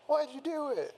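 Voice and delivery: very high-pitched anguished demand; Teddy. A narrator's reading. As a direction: demand it in a high, upset character voice. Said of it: "trying to hold back his sadness and anger".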